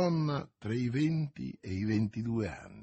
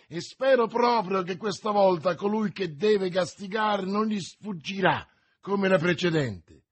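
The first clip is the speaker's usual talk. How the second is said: raised, forceful challenge; exclaiming; 6 semitones higher, 4 dB louder than usual. A narrator's reading. D'Artagnan challenges the stranger who must be punished, exclaiming. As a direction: throw it out high and heated. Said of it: "esclamò".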